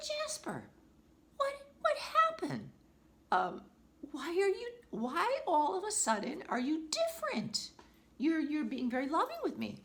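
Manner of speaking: retelling her high astonished plea; shocked. Retold or imitated. Owner retelling asking her parrot why he changed, astonished. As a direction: ask in amazed disbelief, voice up high. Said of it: "And I was shocked".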